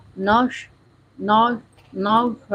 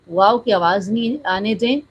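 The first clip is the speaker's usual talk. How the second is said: normal talking voice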